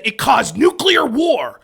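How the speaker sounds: loud, slow, drawn-out proclamation; hyperbolic claim. Acted. Debater inflating a modest argument, loud and overblown. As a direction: blow it up into a huge, dramatic impact.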